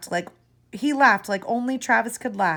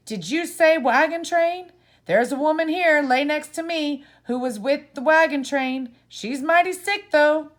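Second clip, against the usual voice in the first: raised, higher calling voice; 6 semitones higher, 5 dB louder than usual. A narrator's reading. Stranger calls across the sick ward, raised and high. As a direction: call out across a crowded sickroom.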